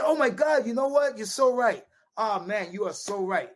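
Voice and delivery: suddenly high, gushing fake agreement that fades back down; mock agreement. To the North Korean guide, backing off with hammed-up agreement. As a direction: cave in with over-the-top enthusiasm.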